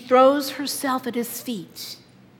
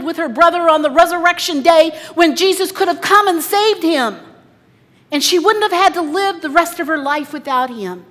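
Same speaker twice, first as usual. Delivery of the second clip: loud, high, driving preacher voice; a preacher pouring it out over the crowd; preaching at full force; 6 semitones higher, 10 dB louder than usual. Acted. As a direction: push it out with rising fervour. Preacher builds emotional retelling.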